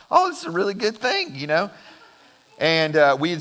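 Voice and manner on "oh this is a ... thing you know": high-pitched, pleased realization; retold excitement. Boy sees his parents' reaction to his news, retold excitement. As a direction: imitate a kid's delighted surprise, pitch jumping up. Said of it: "they got really excited. I'm like".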